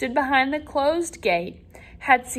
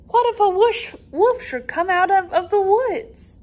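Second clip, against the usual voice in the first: put-on a higher, anxious old man warning about wolves; worried grandfather; 8 semitones higher than usual. Grandfather warning Peter about wolves, higher and anxious. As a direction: fret like a grandfather scared for the boy.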